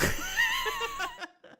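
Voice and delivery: squealing burst of laughter; high shrieking laugh. Real, not acted. Laughing at dartboard shield.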